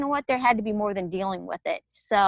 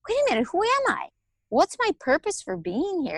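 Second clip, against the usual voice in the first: higher, startled inner questioning; alarmed self-questioning; 9 semitones higher than usual. Retold or imitated. Mother questioning her identity, alarmed. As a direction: ask yourself with sudden alarm. Sudden identity crisis, quoting herself.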